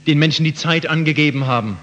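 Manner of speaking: ordinary talk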